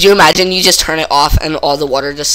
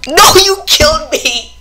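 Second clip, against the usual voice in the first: high indignant yelp; 12 semitones higher, 5 dB louder than usual. Real, not acted. Partner's mistake kills him.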